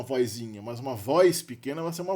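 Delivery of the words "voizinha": "vozinha" said "voizinha"; inserted i glide. Shown as a demonstration. Teacher ruling out the variant for small grandmother, i glide in "vozinha". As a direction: put an i before the z in "vozinha". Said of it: "nunca vai ser uma".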